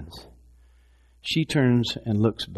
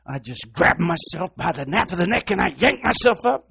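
quoting a minister friend; 6 semitones higher than usual. Retold or imitated. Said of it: "she would say".

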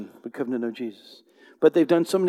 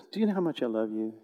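tender voice of the Lord; 4 semitones lower, 5 dB quieter, faster than usual. Acted.

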